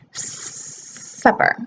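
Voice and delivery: supper with a long hissed S, teeth almost together; correct S. Shown as a demonstration. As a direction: hold the S in supper, tongue behind the teeth. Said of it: "So when we say the S".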